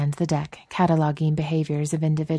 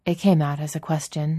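ordinary delivery

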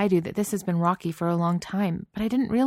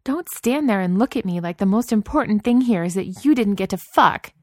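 rising from firm to a furious shout, higher and louder; building angry shout; 5 semitones higher, 5 dB louder than usual. A narrator's reading. Wife yelling at her dismissive husband, building to a furious shout. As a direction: let the anger build until she is yelling. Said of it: "She was shouting by the end of it".

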